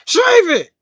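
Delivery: high, loud mock-robber yell; shouted demand. Robber demanding a man's mustache, shouted order. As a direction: bellow the order like a stick-up.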